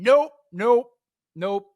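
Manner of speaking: high, loud mock objections; heckler yelling out. Heckler objecting to a digital marketing talk, mock calling out. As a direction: interrupt with repeated, rising imitated heckles. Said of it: "I've had guys yell out".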